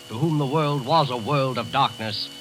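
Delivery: ordinary talk, nothing marked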